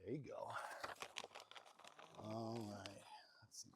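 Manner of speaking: quick soft whisper. Real, not acted. Feeding a yearling, soft whisper.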